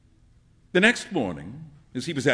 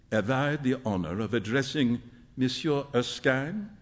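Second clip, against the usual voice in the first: soft, lower voice, lightly French; polite inquiry with a slight French accent; 4 semitones lower, 5 dB quieter than usual. A narrator's reading. Old gentleman greets Hughie formally, slight French accent. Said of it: "said in a slight French accent".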